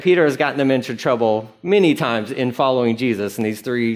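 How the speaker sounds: normal talking voice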